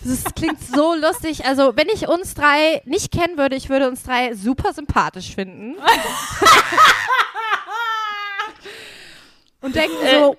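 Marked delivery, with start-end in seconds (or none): 5.8-8.9 squealing laugh. Real, not acted. Laughs at own joke.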